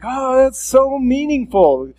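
over-the-top pious swoon; mock gushing piety. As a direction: ham up a showy churchgoer. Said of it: "And be like".

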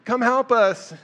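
high, loud desperate call; crying out. Retold or imitated. Blind men crying out to Jesus for healing, loud pleading call. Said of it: "crying out to be healed".

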